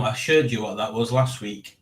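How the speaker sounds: normal talking voice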